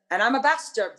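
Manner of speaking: ordinary talk, nothing marked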